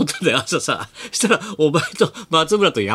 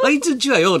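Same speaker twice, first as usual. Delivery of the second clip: imitating tipsy local; 11 semitones higher than usual. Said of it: "なんて話始まっちゃってさ".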